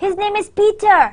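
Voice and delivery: high, emphatic PETER in surprise; surprised disbelief. Shown as a demonstration. Teacher modelling surprise at a name, high and disbelieving. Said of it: "That's kind of a surprised".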